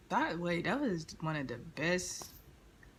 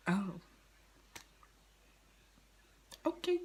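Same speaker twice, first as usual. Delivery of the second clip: meek surprise; 4 semitones higher, 5 dB quieter than usual. Retold or imitated. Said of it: "I'm like".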